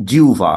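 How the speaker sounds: জিহ্বা said as jiu-va; two-part jiu-va; correct pronunciation. Shown as a demonstration. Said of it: "আমরা সঠিক উচ্চারণ করার চেষ্টা করব".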